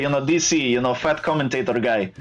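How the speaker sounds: put-on Russian-accented English; Khabib accent. New guest commentator joking about his hoped-for job, Russian accent. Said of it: "what does Khabib say".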